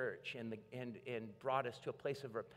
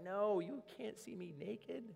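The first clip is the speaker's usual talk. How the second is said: slow, higher mock protest; mock proud protest; 9 semitones higher, slower than usual. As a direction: act out wounded pride. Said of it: "My pride would have been like".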